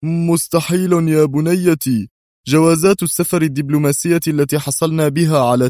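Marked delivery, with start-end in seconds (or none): 0.0-2.1 firm denial. A narrator's reading. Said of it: "هز قدري رأسه في قوة قائلًا".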